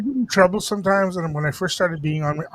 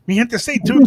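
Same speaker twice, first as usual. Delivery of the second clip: loud, high call to the audience; radio host hype; 8 semitones higher, 7 dB louder than usual. Acted. Host calling out to his listeners, loud hype. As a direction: pump up the listeners.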